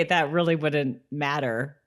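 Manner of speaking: normal talking voice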